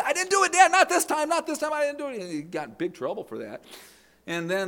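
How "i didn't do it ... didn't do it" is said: high pleading protest; protesting boy. Retold or imitated. Ed pleading with his dad that he didn't do it, high and pleading. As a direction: plead your innocence like a kid.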